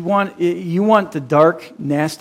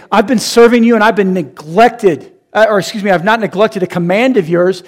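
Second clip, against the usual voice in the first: louder, higher aggrieved complaint; resentful complaint; 5 semitones higher, 6 dB louder than usual. Retold or imitated. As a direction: list your grievances with hurt pride. Resentful son complaining to father.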